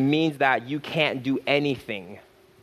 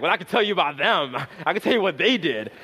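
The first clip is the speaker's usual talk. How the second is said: mock gossip voice; 4 semitones higher, faster than usual. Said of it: "Now you're like".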